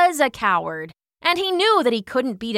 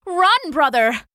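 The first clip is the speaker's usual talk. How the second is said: high, urgent call to a brother; urgent shout; 6 semitones higher, 4 dB louder than usual. A narrator's reading. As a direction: shout down from the wall to urge him on. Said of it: "Paris shouted".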